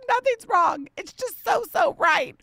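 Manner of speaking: so right, almost shouting; emotional high exclaim. Retold or imitated. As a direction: gush it out, tearful and happy. Her tearful answer to husband.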